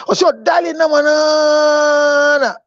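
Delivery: high mock wail, dragged out on the last words; wailing cry. Wife sobbing down the phone at her husband, a high dragged wail.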